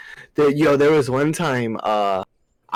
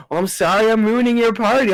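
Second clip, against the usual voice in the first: sarcastic apology; 8 semitones higher, 3 dB louder than usual. Retold or imitated.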